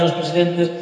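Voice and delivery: ordinary talk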